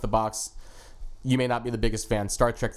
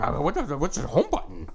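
put-on confused old boomer voice; cranky boomer fumbling with tech; grumbling out of touch boomer. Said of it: "that's my baby boomer voice now".

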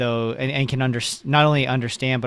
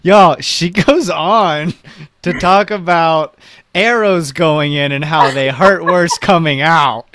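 laughing; 5 semitones higher, 9 dB louder than usual. Real, not acted. Laughing while teasing the song.

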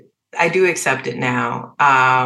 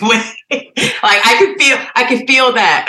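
laughing; 8 semitones higher, 5 dB louder, slower than usual. Real, not acted. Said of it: "laughs while saying".

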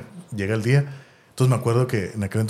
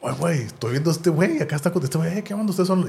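starstruck fan; 6 semitones higher than usual. Retold or imitated. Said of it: "Y yo estaba así de que".

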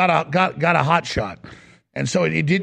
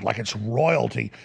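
usual speaking voice

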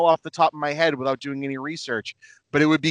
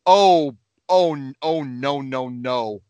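robot voice; 4 dB louder, much slower than usual. Retold or imitated. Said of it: "going like".

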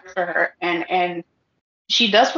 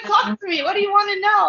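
eager; 10 semitones higher, faster than usual. Retold or imitated. Eager reply to curious people.

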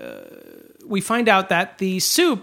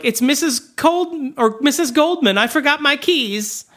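lightly voiced woman calling; mock caller; 8 semitones higher than usual. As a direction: call through the door sweetly. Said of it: "she's like".

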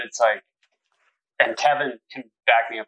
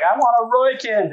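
put-on high, louder drunken yell; 10 semitones higher, 3 dB louder than usual. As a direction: belt it out like a happy drunk. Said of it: "he's just like".

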